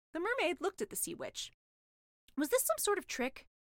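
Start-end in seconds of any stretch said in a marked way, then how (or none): none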